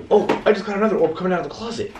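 high, fast excited outburst; startled excitement. Real, not acted. Investigator spotting orb from closet, startled and excited. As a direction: blurt it out high and rushed.

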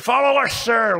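high, loud, eager "follow us, sir"; retold crew reply. Crew member leading the president, high and eager. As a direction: chirp it up like a keen aide.

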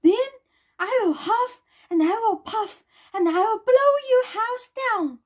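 mock roar, pitched high; lightly acted wolf threat. A narrator's reading. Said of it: "roared the wolf in his loudest voice".

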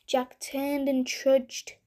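plain reading voice

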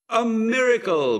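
high, loud exclamation; ringing cry of joy; jubilant cry. A narrator's reading. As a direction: shout the news in amazement. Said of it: "cried the arrival".

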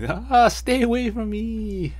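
high, slow panicked yell; panicked high cry. Retold or imitated. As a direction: back away in terror. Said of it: "he's yelling".

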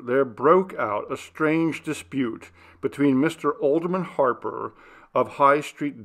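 usual speaking voice

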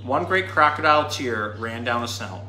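plain reading voice

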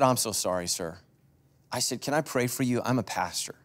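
hushed pastoral sympathy; gentle sympathy. Retold or imitated. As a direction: soothe a grieving old man. Consoling grieving man at vet.

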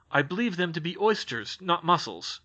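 usual speaking voice

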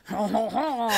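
a lightly put-on French chuckle; a faint French accent on a chuckle; bad French accent. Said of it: "Why can't I do a French accent?".

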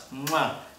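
kiss sound. Real, not acted. Blowing kisses to viewers.

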